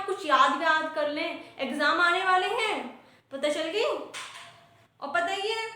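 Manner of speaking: teacher scolding students. Acted. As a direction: scold a class of children into opening their books. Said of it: "ऐसी डांट लगानी पड़ती है". (बच्चों को डांटती टीचर)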